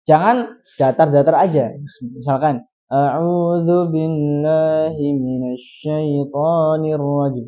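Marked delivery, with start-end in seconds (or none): 2.9-7.4 A'udzu billahi minasyaitonir rajim very slow and monotone, no mouth movement; flat, motionless reading. Shown as a demonstration. Teacher showing students the flat, wrong reading. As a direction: drone it flat without moving the mouth. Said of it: "Tidak ada pergerakan".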